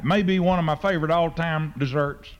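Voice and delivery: ordinary talk, nothing marked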